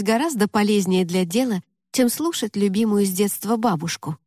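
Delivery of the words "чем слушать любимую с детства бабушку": ordinary delivery, nothing marked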